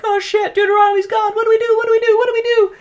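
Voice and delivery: high panicked shouting; panicked cats. Retold or imitated. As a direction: squeal in panic, high and loud. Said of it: "So they're like".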